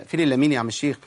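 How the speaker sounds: ordinary talk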